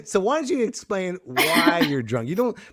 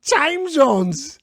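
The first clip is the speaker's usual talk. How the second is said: raised-pitch mock drunk exclamation; imitating drunk guest; 5 semitones higher than usual. Host teasing drunk Cheryl, imitating her. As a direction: mimic a tipsy guest's big discovery. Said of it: "and you're like".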